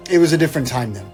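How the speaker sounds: ordinary talk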